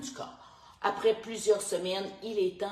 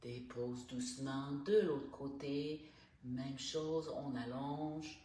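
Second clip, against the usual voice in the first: calm, unhurried instructor guiding each breath; guided stretching voice; 7 dB quieter than usual. Acted. Morning stretch coach guiding listeners through neck and shoulders, calm. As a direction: talk the class softly through every stretch.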